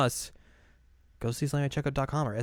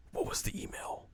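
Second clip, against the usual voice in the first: wondering whisper. Retold or imitated. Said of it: "to be like".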